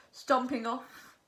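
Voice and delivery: plain reading voice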